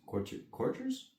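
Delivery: courtiers? asked high and rising; questioning pronunciation. Shown as a demonstration. Reader doubts the word, high rising. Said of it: "I don't know if I pronounced".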